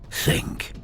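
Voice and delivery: ordinary delivery, nothing marked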